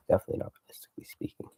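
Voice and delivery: quick whisper under the breath; fast whispered aside. Real, not acted. Rasches Flüstern als Nachsatz.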